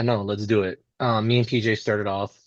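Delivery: ordinary delivery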